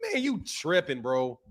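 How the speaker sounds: ordinary talk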